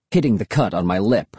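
ordinary delivery

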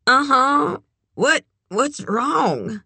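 high-pitched, slow groggy answer; just woken, drag out the words in a high voice. A narrator's reading. Red picks up the late phone call, groggy. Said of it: "picked up sounding groggy".

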